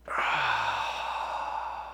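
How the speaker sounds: long breathy sigh of release, like fresh air; relieved sigh. Retold or imitated. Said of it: "and you went".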